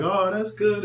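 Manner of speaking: lightly voiced parent approving; parent's voice. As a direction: parent mildly pleased with the chore report. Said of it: "the parent be like".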